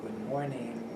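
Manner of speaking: very soft, low "Good morning"; language learner's greeting. Shown as a demonstration. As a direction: say it timidly low, like a careful learner. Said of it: "That's like a language learner".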